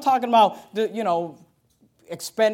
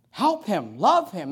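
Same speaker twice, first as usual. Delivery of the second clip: ordinary delivery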